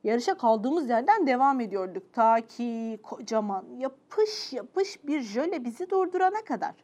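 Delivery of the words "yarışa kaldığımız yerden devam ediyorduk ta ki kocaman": plain reading voice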